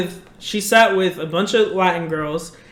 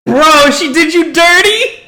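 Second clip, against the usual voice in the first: shocked; 8 semitones higher, 8 dB louder, slower than usual. Real, not acted. Shocked at the betrayal.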